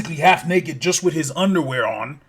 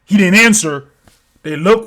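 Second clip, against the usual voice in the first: ordinary talk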